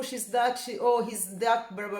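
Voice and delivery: normal talking voice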